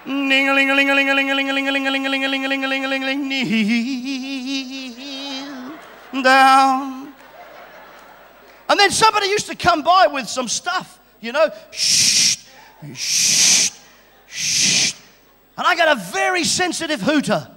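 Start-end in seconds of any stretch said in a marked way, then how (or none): 0.0-5.8 sung ling-a-ling bell jingle; imitated church bell. 6.1-7.1 loud, solemn order to kneel; mock priest command. 11.7-14.9 sharp unvoiced shh bursts; imitated censer hiss. Imitating incense swinging.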